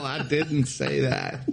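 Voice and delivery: amused denial through laughter; real laugh running through the words. Real, not acted. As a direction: protest while still chuckling. Laughing protest at being teased.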